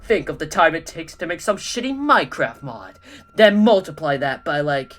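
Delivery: loud, furious rant; ranting Mysterio. Acted. Mysterio ranting about his ruined masterpiece. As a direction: vent your outrage.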